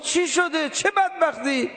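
high-pitched, startled questions; alarmed questioning. Acted. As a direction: ask urgently, pitch jumping up in alarm. Habib alarmed at bad news. (پرسش هراسان با صدای زیر)

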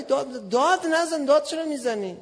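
retold heated objection; indignant protest. Retold quarrel with officer.

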